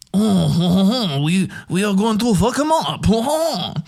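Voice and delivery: mock French queen with swooping, haughty "oh-oh-oh"; French accent. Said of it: "like, a French accent".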